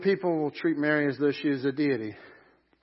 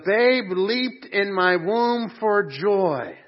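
loud, slow, higher-pitched exultant quote; joyful proclamation; 5 semitones higher, 7 dB louder, much slower than usual. Retold or imitated. Preacher quotes an expectant mother's joy to the congregation, joyful proclamation. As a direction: proclaim the scripture joyfully, stretching the words. Quoting the pregnant woman's joyful cry.

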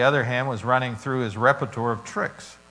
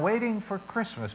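"waiting for Christmas" said high and soft, set apart; lifted, soft label; 8 semitones higher, 6 dB quieter than usual. Shown as a demonstration. Pastor gives the congregation a note for their Bibles, lifted and soft. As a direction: lift "waiting for Christmas" up and soften it like a note to write. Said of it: "you can put down".

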